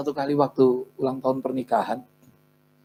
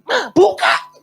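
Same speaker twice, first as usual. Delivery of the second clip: very high, loud, exasperated demand; half-angry yell; 11 dB louder, slower than usual. Retold or imitated. Husband locked out with a tiger, yelling half angrily. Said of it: "Setengah marah saya".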